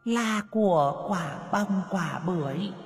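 a ghost hissing a curse; eerie furious hiss. A narrator's reading. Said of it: "Giọng nói ma mị, mang theo sự phẫn nộ, lại rít lên".

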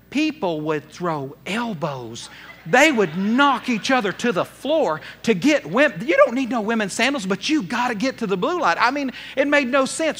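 usual speaking voice

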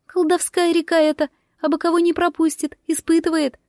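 high-pitched firebird voice; high, even creature voice. A narrator's reading. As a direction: speak high as a magic bird. Firebird speaks in a story.

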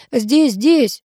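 urgent but restrained shout; calling out. A narrator's reading. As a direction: call to them for rescue. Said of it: "закричал Лебедев".

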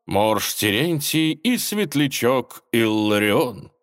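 slow, loud, grand stage announcement; booming prize announcer. Acted. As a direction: announce finalists like a gala host. Host announcing finalists.

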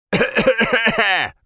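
high, rough hacking cough; harsh cough. Acted. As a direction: cough as if choked by smoke. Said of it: "Закашлялся он". (надрывный кашель)

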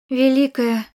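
stopping short mid-word; cut off abruptly. Acted. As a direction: catch yourself and stop. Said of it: "Я осеклась".

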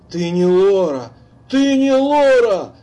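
high, loud, slowing frightened cry; near-shout. A narrator's reading. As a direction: yell it down the phone in panic. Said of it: "почти закричал в трубку".

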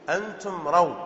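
ordinary delivery

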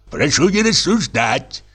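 a hint of an old man's command. A narrator's reading. Old director orders through the wall, lightly voiced. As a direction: bark it like a peevish old boss. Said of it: "старческий голос".

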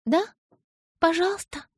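put-on high, pleading little girl; pleading child. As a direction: ask like a small girl begging. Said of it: "попросила она".

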